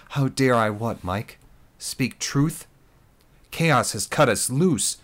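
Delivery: loud, rising, scornful retort; defiant challenge. Acted. Lisa challenging Mike before the gathered troops, defiant and loud. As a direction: throw his words back at him.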